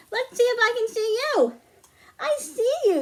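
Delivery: high, bright peekaboo call; playful peekaboo. Acted. Librarian peeking at the children through paper-tube binoculars, playful and high. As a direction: delight the kids as if spotting them.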